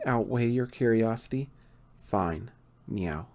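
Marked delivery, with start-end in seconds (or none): 2.1-3.3 a much softer, lower, reluctant mutter; embarrassed mumble. Acted. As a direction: barely get the meow out, ashamed. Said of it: "Hmm, I can't hear you".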